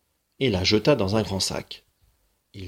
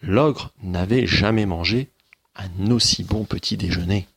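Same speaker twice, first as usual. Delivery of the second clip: ordinary delivery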